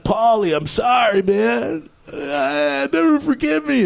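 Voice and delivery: high, tearful pleading; crying apology. Retold or imitated. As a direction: sob out the apology.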